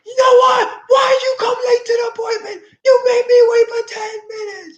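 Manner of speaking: high shouted scolding; mock furious shout. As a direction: yell angrily at someone late. Acted angry outburst at someone.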